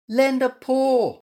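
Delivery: high, slow call for help; crying out. A narrator's reading. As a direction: call up for a hand. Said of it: "cried Stripey".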